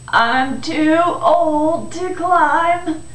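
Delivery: put-on very slow, loud elderly voice; old man. The aged boy is too old to climb, elderly voice. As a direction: stretch every word like an aged man.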